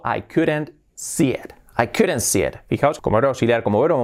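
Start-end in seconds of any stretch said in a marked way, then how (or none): 0.0-1.5 slow, spaced-out teacher's example; slow model sentence. Shown as a demonstration. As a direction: say it slowly for learners to copy. Teacher models sentence slowly, then naturally. (frase modelo, despacio)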